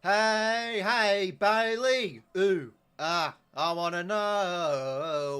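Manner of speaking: high, slow mocking sing-song; taunting call. Real, not acted. Fan taunting rival player Bailey through the screen, high mocking sing-song. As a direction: drag it out to needle the rival.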